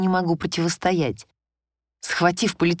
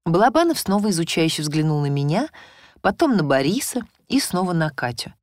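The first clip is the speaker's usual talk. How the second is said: ordinary delivery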